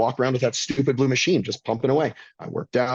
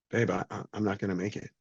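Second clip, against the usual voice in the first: quiet farewell; 2 semitones lower, 8 dB quieter than usual. Retold or imitated. Farewell message to his wife.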